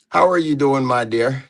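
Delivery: ordinary delivery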